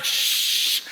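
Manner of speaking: voiceless shh; hushing. Retold or imitated. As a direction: hush the clerk fast. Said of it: "je fais".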